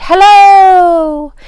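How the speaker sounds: high, loud call from a mountaintop; loud, high hello thrown to the mountains; shouted hello. Retold or imitated. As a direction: call it out across the valley. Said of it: "and I shouted".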